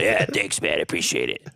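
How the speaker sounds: squeezed throaty imitation, swooping down low; throaty gym guy. Retelling the huge gym guy's thanks, throaty mock voice. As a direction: swallow the words like a man with no neck. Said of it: "as if he didn't have a throat".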